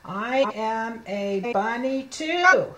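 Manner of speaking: slow, stretched words; slow model reading. Shown as a demonstration. Teacher reading next rabbit page, slow word-by-word. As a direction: read word by word, lengthening too.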